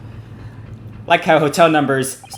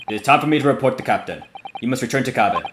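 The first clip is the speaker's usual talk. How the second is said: lightly voiced Russian guard, curt; a hint of a Russian accent, ending the talk. Guard cutting off questions, light Russian accent. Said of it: "I might be trying to do their accent".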